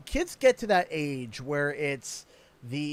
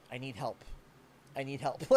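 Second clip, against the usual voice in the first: put-on softer, small toddler plea; imitating toddler; 6 semitones lower, 13 dB quieter, faster than usual. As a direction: mimic a little kid quietly begging for help. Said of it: "and he'll put his hand and go".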